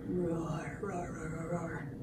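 soft, low dinosaur rumble; quiet roaring. Acted. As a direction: roar low under your breath. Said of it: "gonna be lots of roaring".

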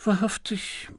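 a thoughtful aside to oneself; nachdenklich. A narrator's reading. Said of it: "sagte Lord Peter nachdenklich zu sich selbst". (nachdenklich zu sich selbst)

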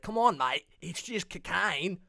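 coaxing Australian, building to a loud high finish; Australian accent. Acted. Bandmate coaxing hesitant [name], mock Australian accent. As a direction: press him in mock Australian. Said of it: "or, like, Australian, like the Bee Gees".

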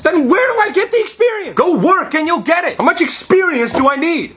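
high exasperated shouting; frustrated shout. Acted. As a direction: yell it in disbelief. Frustrated job seeker argues.

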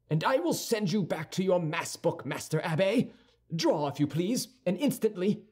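high-pitched, heated challenge; quarrelsome young Gascon. A narrator's reading. D'Artagnan daring Aramis to draw, quarrelsome. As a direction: demand a duel, pitched well up.

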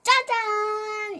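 high, slow, drawn-out reveal; excited ta-da. Real, not acted. Crafter revealing the finished wheels to viewers, excited. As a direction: savor the big finish.

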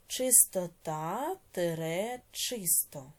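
words drawn out one by one; slow dictation. Shown as a demonstration. Teacher dictating word pairs to pupils, slowly. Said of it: "teacher dictates words slowly".

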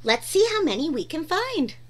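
usual speaking voice

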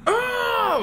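a sudden high cry of surprise; yelp, caught off guard; startled yelp. Real, not acted. Startled by the game, a high yelp.

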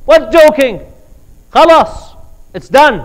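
loud, high scornful shout; angry retort. Retold or imitated. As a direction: shout him down, it's over. Family rejects husband's excuse.

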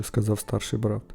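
plain reading voice

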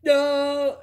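high, held cry of revelation; eureka moment. Acted. Telling viewers about the eureka moment, acting out high-pitched awe. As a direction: draw it out high like a sudden revelation. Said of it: "was like a eureka moment".

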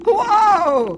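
very loud, high shout of surprise; startled whoa. A narrator's reading. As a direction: jump back startled. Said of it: "Hoppy said".

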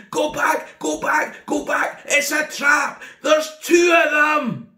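high, loud terrified warning; panicked shout. Retold or imitated. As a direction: scream the warning while fleeing. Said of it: "he says to them".

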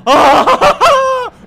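high, loud shrieking laugh; piercing burst of frightened laughter; panicked laugh-scream. Real, not acted. Sees a trench ahead.

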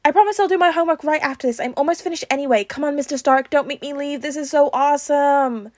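put-on high, loud eager kid; excited pleading. A narrator's reading. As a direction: plead excitedly to be allowed to stay. Peter pleading excitedly to stay.

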